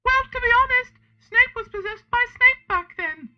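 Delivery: very high falsetto figure; matter-of-fact explanation in a silly high voice; put-on character voice. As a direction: squeak it out in falsetto. Voices James in the story.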